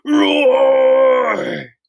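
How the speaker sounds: loud high monster roar, held; big roar. A narrator's reading. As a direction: shake the earth. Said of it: "earth-shaking roar".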